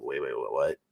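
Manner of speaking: low, muffled stammer of disbelief; stunned double-take. Retold or imitated. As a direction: drop the voice right down, thrown off. Acts stunned reaction to job offer.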